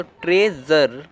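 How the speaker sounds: "ट्रेजर" said slowly, with "अ" in the second syllable; slow pronunciation demo. Shown as a demonstration. Teacher modelling "ट्रेजर" for learners, slowly. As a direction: say "ट्रेजर" slowly, "ट्रे" then "जर". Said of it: "इसमें यू को आप अ पढ़ रहे हो".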